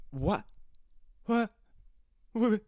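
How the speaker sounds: halting, dumbfounded stammer, pitch rising at the end; lost for words, mock-stunned; retold state of shock. Son stunned by the dead mouse, sputtering in shock. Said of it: "in a state of shock".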